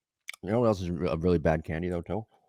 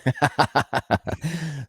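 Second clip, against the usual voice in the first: high laugh; 12 semitones higher than usual. Real, not acted. Laughs at crow joke.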